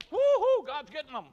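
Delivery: very high, loud whooping cheer; mock football cheer. Gentiles cheering God punishing Israel, loud stadium whoop.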